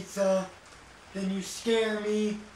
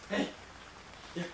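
ordinary talk, nothing marked

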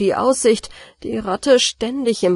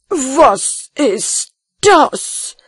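loud, slow, higher threatening shout; 5 semitones higher, 7 dB louder, much slower than usual. Acted. Master slipping in syrup shouts threateningly. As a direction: bellow it like a menacing master. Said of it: "schrie er mit drohender Stimme".

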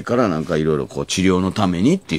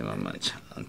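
low, soft grandma's call; lightly voiced grandma; 10 semitones lower, 14 dB quieter than usual. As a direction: murmur it like an old grandma. Grandma calling, imitated.

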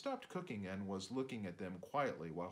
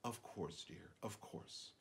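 soothing; 3 semitones lower, 8 dB quieter, slower than usual. Acted. Mom soothing dad.

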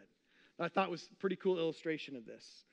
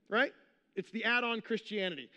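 ordinary delivery

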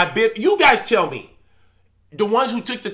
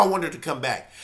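soft echo; 5 semitones lower, 6 dB quieter than usual. Real, not acted. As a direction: drop to a softer, lower repeat. Echoes own plea softly.

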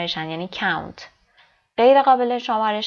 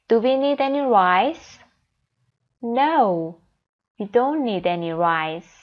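stressed words like "need" and "don't" pushed slightly; slow dialogue with stressed key words; sentence stress demo; much slower than usual. Shown as a demonstration. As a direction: model the stressed words for the class. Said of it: "ببینید فشار روی این کلمات بیشتره".

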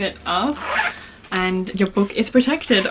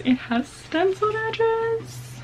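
high, slow, drawn-out gush; high delighted squeal; 13 semitones higher, 4 dB quieter, slower than usual. Real, not acted. Showing the viewers the stenciled edges, high squeal. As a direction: squeal it like you can't believe it.

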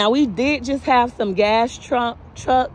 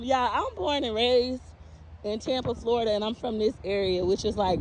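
normal talking voice

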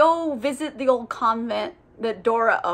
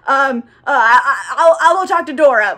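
mock flustered stammer, getting louder; freaking out; 7 dB louder than usual. Vampire who doesn't know what to do, mildly freaking out. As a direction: stumble over the start, lightly panicked. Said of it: "he's freaking out".

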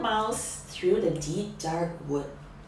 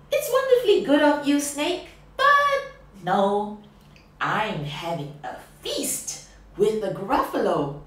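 quick mouse; 8 dB louder than usual. Acted. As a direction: rattle off the polite refusal. Mouse answers snake.

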